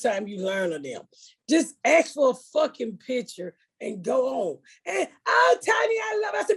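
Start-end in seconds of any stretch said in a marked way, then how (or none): 5.3-6.3 high, shouted whining gush; mock whiny fan meeting Tiny. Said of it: "she whined too".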